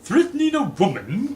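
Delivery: high, loud outraged cry; indignant cry. Acted. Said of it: "cried Colonel Mustard".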